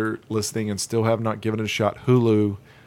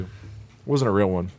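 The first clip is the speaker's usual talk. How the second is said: usual speaking voice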